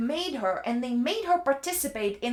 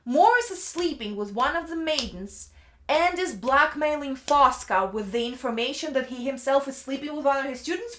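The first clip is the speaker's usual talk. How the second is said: ordinary talk, nothing marked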